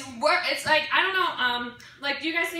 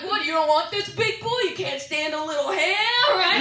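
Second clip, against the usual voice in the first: mock Staten Island mechanic; 5 semitones higher than usual. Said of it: "turn into, like, a Staten Island mechanic".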